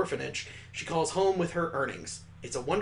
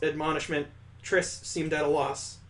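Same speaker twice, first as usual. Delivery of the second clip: ordinary delivery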